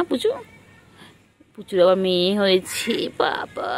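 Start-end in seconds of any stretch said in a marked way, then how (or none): none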